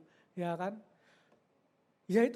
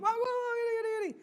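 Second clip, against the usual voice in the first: much higher, heated outburst; angry husband; 11 semitones higher than usual. Acted. Husband arguing with his wife, high angry outburst. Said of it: "Ngomongnya keras, nada tinggi".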